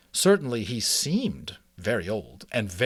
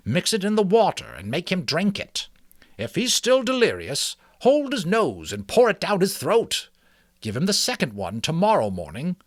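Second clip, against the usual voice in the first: put-on higher, kindly old mouse; 7 semitones higher, 5 dB louder than usual. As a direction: speak as a wise old mouse. Ages speaks.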